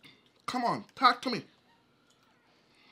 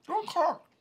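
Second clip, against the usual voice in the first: mocking imitation; 10 semitones higher than usual. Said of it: "You guys I heard said".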